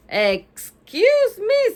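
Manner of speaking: high, slow, drawn-out huff; huffy. A narrator's reading. Ivy cutting off Leo, huffy. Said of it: "still in a huffy voice".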